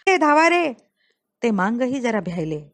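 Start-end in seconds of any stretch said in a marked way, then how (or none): 0.1-0.7 raised, higher and louder call-out; old woman's cry for help. A narrator's reading. Old woman spotting the attack, crying out for help. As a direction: cry out urgently to summon help. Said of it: "एका म्हातारीने ओरड केली".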